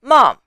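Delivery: mom falling sharply from high; fourth tone. Shown as a demonstration. Teacher showing tones on an English word, falling tone. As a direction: start mom high and drop it fast. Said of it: "The first is the tones".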